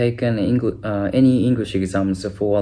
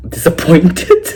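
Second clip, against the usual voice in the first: laughing; 6 semitones higher, 6 dB louder, slower than usual. Real, not acted. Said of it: "I felt kind of".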